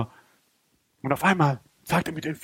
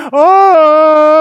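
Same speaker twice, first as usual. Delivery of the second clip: long high, loud wail; anguished yell; 8 semitones higher, 10 dB louder than usual. Acted. Preacher acting out the next day's relapse, anguished yell. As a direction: cry out loudly in exasperation.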